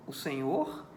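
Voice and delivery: ordinary delivery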